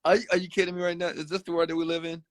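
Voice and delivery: can't believe what you're seeing; incredulous disbelief. Retold or imitated. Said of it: "I'm like".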